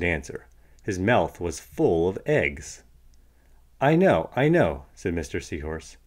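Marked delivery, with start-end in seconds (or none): 3.8-4.9 knowing reassurance. Acted. Mister Seahorse answers for Tilapia.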